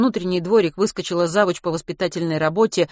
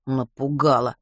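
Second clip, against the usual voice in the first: scoffing; 3 semitones lower, slower than usual. A narrator's reading. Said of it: "хмыкнул Матвей".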